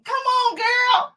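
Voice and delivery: high, squealed coaxing; teasing high-pitched plea; playful urging. Real, not acted. Playfully urging the tearful viewer.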